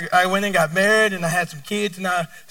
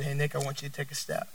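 gentle voice of God; 8 semitones lower, 15 dB quieter, faster than usual. Retold or imitated. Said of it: "So God said".

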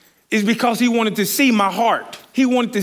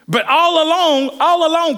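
preaching emphasis; 7 semitones higher, 3 dB louder than usual. Acted. Preacher to congregation, raising the point about Peninnah.

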